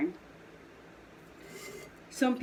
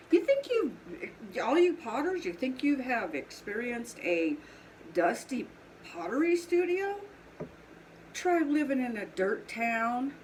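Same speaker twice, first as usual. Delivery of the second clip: angry southern; 5 semitones higher than usual. Real, not acted. Fed-up potter challenging other potters about dusty towns, angry southern. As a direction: let the southern twang come out as you get worked up. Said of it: "When I'm angry, I get a lot more southern".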